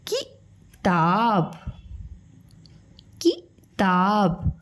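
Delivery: किताब said very slowly, each syllable drawn out; slow syllable demo. Shown as a demonstration. Teacher to young pupils, sounding out किताब slowly. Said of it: "और ये क्या है?".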